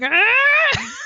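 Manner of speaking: high, loud mock baby scream; a toddler yelling at mom from the playpen, high mock scream; baby's shout. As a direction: let out a sharp toddler yell. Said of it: "she'll just be like".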